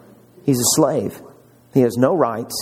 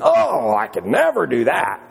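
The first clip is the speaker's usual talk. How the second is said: mock reluctant excuse; 7 semitones higher than usual. Mocking a reluctant churchgoer.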